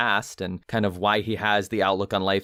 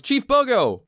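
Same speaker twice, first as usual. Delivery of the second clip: high, loud calling out; yelling; 13 semitones higher, 4 dB louder, slower than usual. Retold or imitated. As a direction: burst in calling the chief. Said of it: "who yells".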